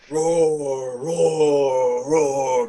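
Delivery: lightly voiced giant roaring; a mock giant bellow, drawn out. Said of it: "you hear Bohican go".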